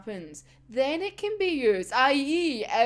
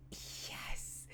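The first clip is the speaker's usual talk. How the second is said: breathless whispered yes; 20 dB quieter than usual. Real, not acted. Fan whispering yes as her favourite arc starts. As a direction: whisper it in glee.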